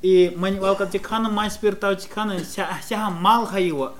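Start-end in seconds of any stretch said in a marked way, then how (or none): none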